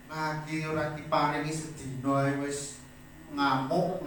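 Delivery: usual speaking voice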